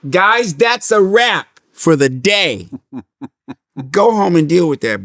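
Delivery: loud, high, drawn-out announcement; calling out. Retold or imitated. Actress dismissing the crew for the day, calling out. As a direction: holler it slowly to the whole crew, playfully.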